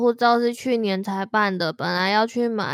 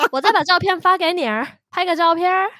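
higher, hammed-up Beijing erhua; Beijing accent; 6 semitones higher than usual. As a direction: stretch the er endings Beijing style. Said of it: "有点北京腔了".

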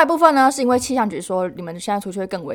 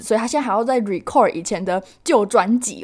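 ordinary talk, nothing marked

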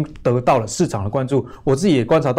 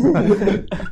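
short laugh; 7 semitones higher than usual. Real, not acted. Host laughs at compliment.

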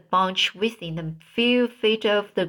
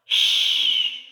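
drawn-out, voiceless "shh"; shushing. A narrator's reading. Tom hushes his friend to listen for a noise, shushing. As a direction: hiss for silence, all breath.

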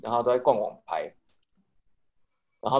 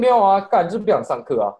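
higher, louder blunt brush-off; imitating the roommate; 8 semitones higher, 7 dB louder than usual. Retelling the roommate refusing class, a mock blunt brush-off. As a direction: blurt it out like you don't care. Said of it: "然后他就说".

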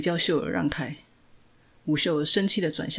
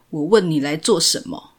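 cold demand; 7 dB louder than usual. A narrator's reading. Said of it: "依然面无表情地说".

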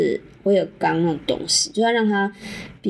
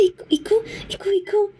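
much higher, whiny mock moans; porn-style moaning; 12 semitones higher than usual. Host mocking Japanese porn clichés, high whimpering mock moans. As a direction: parody a porn actress crying out. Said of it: "就是跟A片一样".

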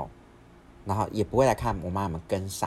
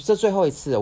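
high, loud retold ultimatum; fed-up warning; 11 semitones higher, 8 dB louder than usual. Sister warning her brother, fed-up warning. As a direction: act out running out of patience.

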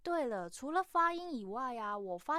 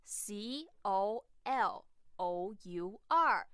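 slow spelling-out; much slower than usual. Shown as a demonstration. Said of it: "英式的拼法是什么啊".

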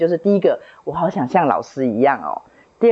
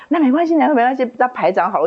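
put-on higher, gentle coaxing to a crying child; soothing teacher; 8 semitones higher than usual. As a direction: comfort her like a kind teacher. (老师高声温柔地哄哭泣的孩子)

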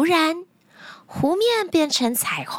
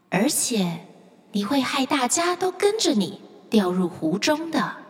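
a calm spirit from the deep; mysterious lake spirit; 5 semitones lower, 3 dB quieter than usual. Acted. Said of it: "湖底传来了一个神秘的声音".

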